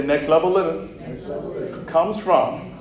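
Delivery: very slow, drawn-out phrase; slow preaching call for echo; slow call to repeat. Shown as a demonstration. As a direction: lay it down word by word. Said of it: "Lord, say".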